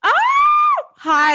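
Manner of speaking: high squeal into a greeting; excited greeting. Real, not acted. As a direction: squeal hello to a welcome caller. Host greets nervous caller excitedly.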